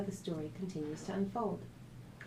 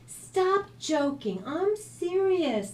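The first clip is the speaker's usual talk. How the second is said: slow, high and loud insistence; high slow protest, I'm serious; upset; 11 semitones higher, 6 dB louder, much slower than usual. A narrator's reading. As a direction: drag out each word, really upset. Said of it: "She was really getting upset".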